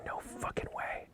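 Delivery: barely audible, stunned whisper; disbelieving whisper. Retold or imitated. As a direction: whisper it under your breath. Buddy reacts secretly to the sighting.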